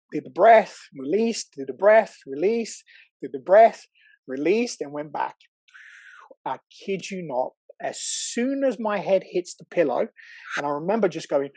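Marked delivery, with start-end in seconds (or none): none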